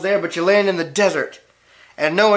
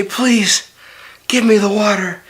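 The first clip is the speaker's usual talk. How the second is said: higher, begging for water; desperate plea, pitched up; pleading; 5 semitones higher than usual. Acted. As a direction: plead like you're parched. Thirsty bride begs for water.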